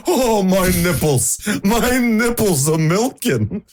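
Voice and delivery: high, slow whining lion voice; hammed-up distressed lion; mock Cowardly Lion. Host imagines the Wizard of Oz lion's complaint, high and slow. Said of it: "if the lion was like".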